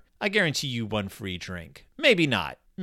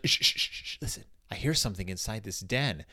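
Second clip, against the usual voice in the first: hushed whisper; 4 semitones lower, 6 dB quieter than usual. Retold or imitated. Brother Bear hushing near den.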